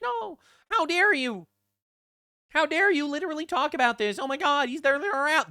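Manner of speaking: shrill-sounding mock of upset fans; mock outraged supporter. Streamer parodying upset supporters, high mock outrage. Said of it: "and they're like".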